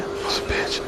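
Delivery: breathy whisper, giving in; whispered submission. Retold or imitated. Woman repeating submissively.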